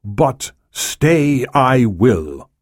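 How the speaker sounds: slow, deliberate resolve; said slowly. Acted. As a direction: weigh each word, slow and immovable. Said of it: "the Prime Minister said slowly".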